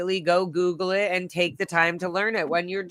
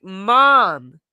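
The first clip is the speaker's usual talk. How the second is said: exasperated call; 9 dB louder than usual. Retold or imitated. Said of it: "And I was like".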